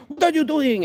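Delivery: high, sharp mock mother's scold; a parent scolding her child in public, high mock scold. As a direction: snap at the kid like a frustrated mom. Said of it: "They'll be like".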